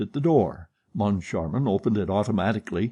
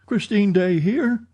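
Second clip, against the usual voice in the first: Christine Daae here asked much higher in disbelief; startled echo; 11 semitones higher, 4 dB louder than usual. A narrator's reading. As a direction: echo the question back, high and amazed. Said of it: "echoed Richard".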